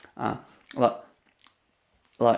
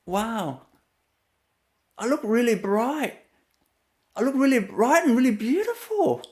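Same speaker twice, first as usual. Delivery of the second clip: delighted self-admiration; 8 semitones higher, 3 dB quieter than usual. Retold or imitated. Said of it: "I just look at myself like".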